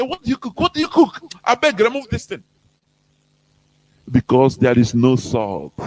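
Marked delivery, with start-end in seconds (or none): 0.0-0.6 a hint of a disgusted guest, soft and high; lightly voiced guest pushing the plate away; mild mock complaint about saltless soup. Imitates a guest rejecting saltless soup. 0.6-2.4 a hint of a disgusted guest, pitched higher; lightly voiced guest rejecting the soup; higher, lightly mocking protest; mock guest complaint. Imitates a guest rejecting saltless soup.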